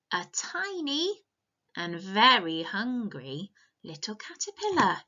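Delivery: put-on lively read-aloud for little kids; storybook reading. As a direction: tell it like a bedtime picture book. Reading picture book to children.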